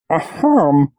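embarrassed cough-like "ахам"; awkward throat clearing. A narrator's reading. Old man under the woman's stare, embarrassed throat clearing. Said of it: "прокашлялся он".

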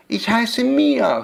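put-on high, slow answer from a nervous girl; timid child, no stutter. A narrator's reading. Girl giving the witch her name, timid but fluent. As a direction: answer high and slowly without stammering. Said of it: "stotterte Mia".

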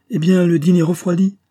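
loud, high-pitched exclamation; hearty call out. A narrator's reading. Count summons his guest to the cooling dinner, calling loudly. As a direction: call out impatiently, pitched well up. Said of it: "s'écria le comte".

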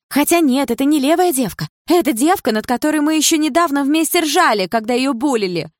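high, loud angry rant; outraged jealous girlfriend; jealous tirade. Acted. Jealous girlfriend rants at boyfriend.

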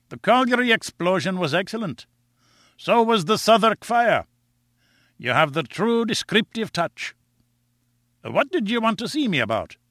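high-pitched, kindly Scottish editor; kindly Scotch accent. A narrator's reading. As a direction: greet him in a high, genial Scots voice. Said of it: "in his kindly Scotch accent".

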